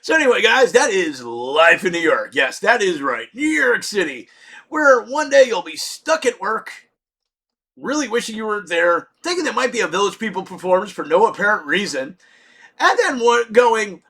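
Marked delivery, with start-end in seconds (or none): none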